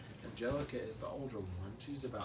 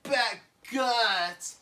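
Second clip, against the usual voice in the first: much higher, louder and slow; gross-out baby; 9 semitones higher, 9 dB louder, much slower than usual. Acted. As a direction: stretch the icky words. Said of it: "Lil's like".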